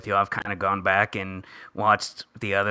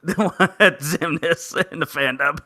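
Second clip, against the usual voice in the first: laughing; 6 semitones higher, 3 dB louder than usual. Real, not acted. Cracking up about Xemnas.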